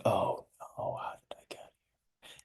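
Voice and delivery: breathless, fading whisper of disbelief; dazed whisper. Acted. Ekin whispers to himself, stunned by memories. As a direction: whisper it to yourself, lost. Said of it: "Ekin is like".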